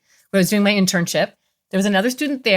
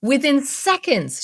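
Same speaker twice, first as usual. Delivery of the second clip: usual speaking voice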